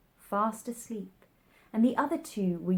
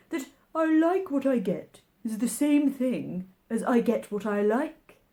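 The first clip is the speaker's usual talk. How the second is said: March Hare voice; 4 semitones higher, 6 dB louder than usual. A narrator's reading.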